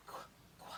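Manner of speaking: "Qu" whispered as kwuh; qu sound. Shown as a demonstration.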